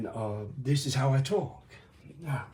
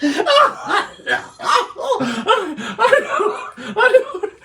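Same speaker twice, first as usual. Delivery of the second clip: laughing; 7 dB louder, much slower than usual. Real, not acted. Laughing fit mid story.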